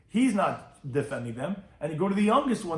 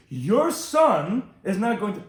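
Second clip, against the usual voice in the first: slow, loud, higher mock scolding; upset wife; 5 semitones higher, 7 dB louder, much slower than usual. Wife scolding her husband, slow mock upset. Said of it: "when the wife gets upset at the husband".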